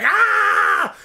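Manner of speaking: high loud hammed-up scream; mock scream. Retelling Bruce Willis opening the orb, mock scream. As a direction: shriek it like a movie hero in agony. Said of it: "he's like".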